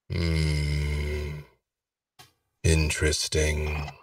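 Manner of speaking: low, soft, slow musing; mysterious lord. Acted. Unseen lord muses from window.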